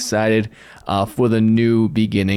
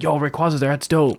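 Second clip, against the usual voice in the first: mock hype; 5 semitones higher than usual. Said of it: "You should be like".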